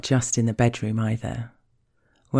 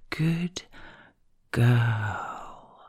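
drawn-out second word. Shown as a demonstration. Partner keeping the speaker waiting for the ending, drawn-out "girl.". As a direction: hold off, then draw out "girl." slowly. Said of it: "you draw out the second word for so long".

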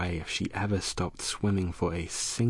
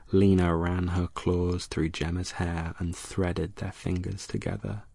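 ordinary delivery, nothing marked

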